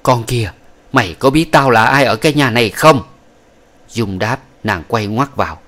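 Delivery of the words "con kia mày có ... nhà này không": louder, higher, contemptuous demand; brutal question. Acted. Old woman brutally questioning the concubine, loud and higher. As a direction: spit the question at her. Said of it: "hỏi rất phũ phàng".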